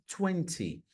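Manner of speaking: TWENTY with a crisply sounded second T; British-style TWENTY, T pronounced. Shown as a demonstration. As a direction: say both Ts in TWENTY. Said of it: "pronunciando o T que existe aqui".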